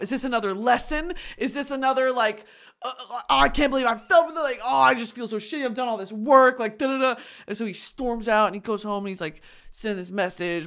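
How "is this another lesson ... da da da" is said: raised, louder mock outrage; hurried, worked-up venting; indignant rant. Man stood up on his date rants, indignant.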